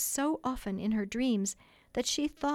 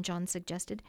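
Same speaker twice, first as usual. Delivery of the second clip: ordinary delivery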